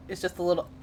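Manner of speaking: usual speaking voice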